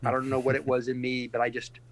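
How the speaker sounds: ordinary delivery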